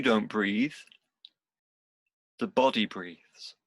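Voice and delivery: unhurried meditation guide, long pauses between phrases; guided meditation. Real, not acted. Teacher guiding seated students into body and breath, guided meditation. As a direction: lead them gently through it, leaving space after each instruction.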